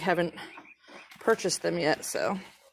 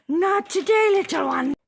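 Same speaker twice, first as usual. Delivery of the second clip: high sing-song pet voice; 8 semitones higher than usual. Real, not acted. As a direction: coo at a little duck. Talking to a duck.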